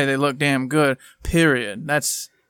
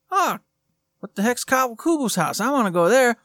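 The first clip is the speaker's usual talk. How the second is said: higher-pitched mock surprise; curious player; 6 semitones higher than usual. Imagining a player spotting the signpost, curious mock surprise. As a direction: imitate a player's sudden discovery.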